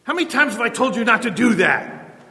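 mock parent losing his temper with his kid; hammed-up angry parent scolding; harsh parent. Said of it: "You're not gonna be harsh".

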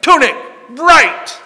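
high, loud mock quiz master demanding an answer. Preacher mocking Jesus as a quiz master, loud. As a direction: bark the fill-in-the-blank like a game show. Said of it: "Jesus does not say".

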